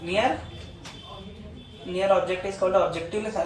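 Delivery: slow, word-by-word dictation; patient classroom dictation. Acted. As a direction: read the definition out for copying. Teacher dictating definitions.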